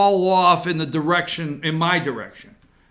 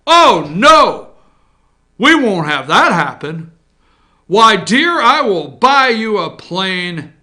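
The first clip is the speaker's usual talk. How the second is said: louder, slow comforting tone; mock soothing husband; 7 dB louder, slower than usual. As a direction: soothe her grandly. Husband reassuring wife.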